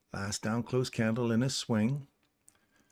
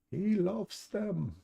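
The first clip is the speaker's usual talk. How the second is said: high, slow sing-song reply; high drawn-out fond voice; playful answer; 10 semitones higher, much slower than usual. Pretend. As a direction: drag it out up high like a fond teacher. Answering own question, playful voice.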